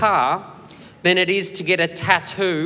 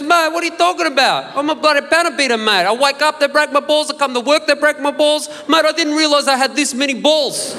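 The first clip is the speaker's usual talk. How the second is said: higher, louder, fast ethnic Aussie tradie venting; ethnic Australian rant; 8 semitones higher, 4 dB louder than usual. Retold or imitated. Panel beater griping to the caller in ethnic Australian accent. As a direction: complain like a fed-up bloke in a thick ethnic accent.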